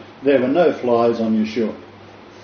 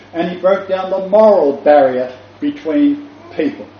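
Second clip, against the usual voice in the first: raised, measured declaiming with big pauses; emphatic preaching; 7 semitones higher than usual. Real, not acted. Preacher tells congregation of broken barriers, emphatic preaching. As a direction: preach each point deliberately, pitched up.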